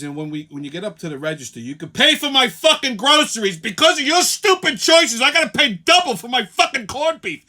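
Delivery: fast rant rising to an angry shout. Retold or imitated. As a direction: tear into her about the groceries. Ranting at the woman in line.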